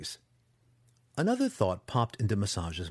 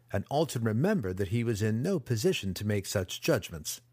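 usual speaking voice

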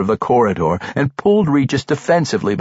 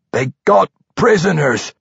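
higher, urgent dwarf voice; 6 semitones higher, 3 dB louder than usual. A narrator's reading. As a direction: blurt out urgent news as a dwarf. Shaken dwarf reporting to friend.

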